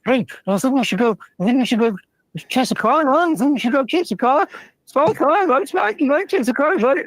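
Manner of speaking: high, yappy sidekick dog voice, eager and pushy; little cartoon dog voice. To the big dog, a high eager little-dog voice. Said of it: "little dog's like".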